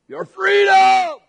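slow, high, roaring shout; Wallace battle cry. Retold or imitated. Pastor quoting Wallace's speech to congregation, slow roaring shout. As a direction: drag out the word like rallying an army. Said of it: "He says".

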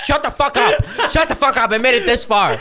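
half-shouting in amused panic; laughing mock yell. Recalling his reaction to being recognised, laughing shout. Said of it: "I'm like".

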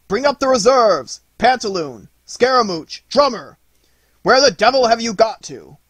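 high, loud battle shout; crying out. A narrator's reading. Nutcracker calling for reserves in battle, urgent shout. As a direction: bellow urgently for reinforcements. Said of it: "cried Nutcracker".

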